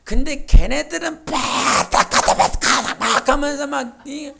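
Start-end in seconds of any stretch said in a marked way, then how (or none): 1.2-3.3 rapid mock Chinese syllables, slowed; mock Chinese chatter. Pastor mimicking Chinese believers' chatter, mock. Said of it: "온갖 소리를 질러대면".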